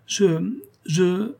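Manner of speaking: a touch of tearful faltering; tearful stammer. A narrator's reading. Countess cannot answer the doctor, tearful stammer. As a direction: stumble on the words, close to tears. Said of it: "les larmes aux yeux".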